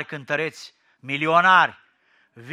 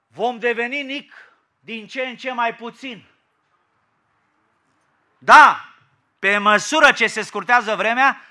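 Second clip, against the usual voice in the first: loud, high, emphatic pulpit delivery; raised preacher's voice driving the point home; forceful preaching; 6 semitones higher, 11 dB louder than usual. Real, not acted. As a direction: proclaim it hard, phrase by phrase.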